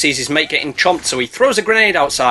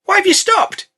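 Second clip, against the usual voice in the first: much higher, friendly snake voice; polite snake. Retold or imitated. Narrator voicing the snake to Alfred, high and polite. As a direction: play the snake oddly courteous. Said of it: "the snake rocks up and is like".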